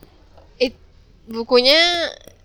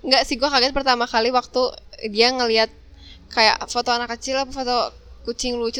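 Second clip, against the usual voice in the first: ordinary talk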